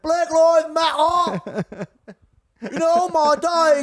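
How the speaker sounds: slow, high cockney drawl breaking into laughs; high British gravedigger, laughing. Retold or imitated. Comic laughing through his gravedigger, cockney accent. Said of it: "one of my favorite British characters".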